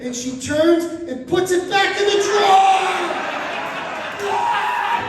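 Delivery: comic outraged yell; outraged shout. Real, not acted.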